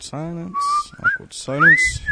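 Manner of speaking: very high squeaky announcer voice. Acted. Announcing the awkward silence to a friend in a squeaky high voice. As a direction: chirp it out in a tiny voice.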